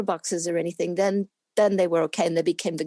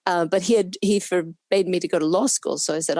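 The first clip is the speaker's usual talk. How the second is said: normal talking voice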